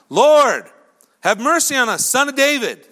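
loud, high imploring call; crying out. Acted. Two blind men calling to Jesus from the roadside, crying out. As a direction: shout it out to be heard. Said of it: "they cried out".

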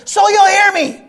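high, loud sharp retort; yelling. Acted. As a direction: shout the answer back. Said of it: "Why are you yelling, preacher?".

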